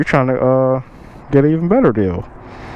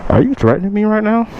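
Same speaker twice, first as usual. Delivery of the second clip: a much higher, disbelieving question; incredulous retort; 10 semitones higher than usual. Retold or imitated. To the buyer threatening him, high disbelieving retort. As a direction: throw it back at him in disbelief. Retold confronting the bike buyer.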